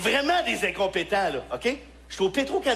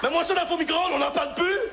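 exasperated yell; 8 semitones higher than usual. Retold or imitated. Comic yells at the night clerk over the sauce, exasperated. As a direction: blow up at the clerk. Yelling at the employee.

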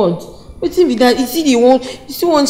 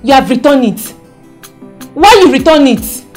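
shouted, indignant questioning; outraged loud protest; loud disbelief; 9 dB louder than usual. Acted. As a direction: demand an explanation at full volume. Wife outraged at returned clothes.

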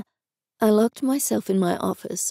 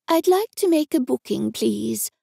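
higher put-on voice, faking another woman; imitating Jenny; 4 semitones higher than usual. Higher put-on voice. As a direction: narrator fakes Jenny's voice on the phone. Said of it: "an imitation of Jenny's voice".

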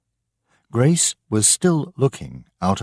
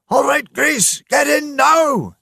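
high, loud shout over the storm; father shouts to Grace over the wind; 5 dB louder than usual. Acted. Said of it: "he shouted".

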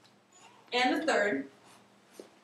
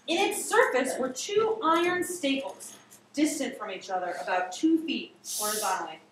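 ordinary delivery, nothing marked

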